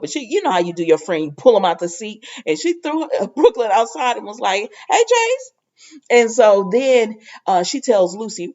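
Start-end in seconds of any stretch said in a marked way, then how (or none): 4.9-5.5 high bright call across the room, much higher. Retold or imitated. Maxie pushing Brooklyn outside, calling out to Chase. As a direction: sing out his name to get his attention.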